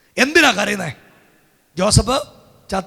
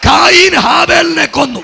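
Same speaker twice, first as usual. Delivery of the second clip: high, forceful preacher's cry; loud proclamation; 7 semitones higher, 10 dB louder than usual. Real, not acted. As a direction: proclaim it loudly, punching the first name. Proclaims the line to congregation.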